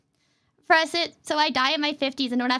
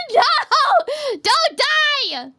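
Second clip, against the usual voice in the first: much higher, louder cry; alarmed shout; 12 semitones higher, 6 dB louder, much slower than usual. Real, not acted. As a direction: yell it to stop them. Pleading with chat member.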